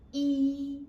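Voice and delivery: E held long on one high, level pitch; high flat tone. Shown as a demonstration. Teacher models first tone for pupils, high flat tone. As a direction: say E high and loud without gliding. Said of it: "flat tone is a high-pitched tone".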